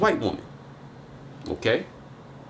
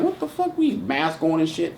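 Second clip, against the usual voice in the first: startled disbelief; 6 dB quieter than usual. Retold or imitated. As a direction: react like someone caught off guard. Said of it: "I'm like".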